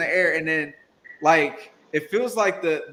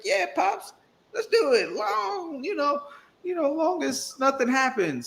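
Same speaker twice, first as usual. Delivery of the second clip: squeaky, much higher put-on voice of a nervous son; 11 semitones higher than usual. Son answering his father about parasailing, squeaky and high. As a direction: agree to it but sound scared, pitched way up.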